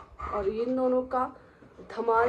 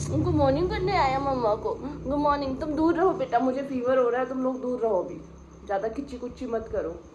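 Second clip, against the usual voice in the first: high, cooing pet-owner voice, a bit louder; 6 semitones higher, 4 dB louder than usual. Real, not acted. To her dog greeting mama, cooing baby talk.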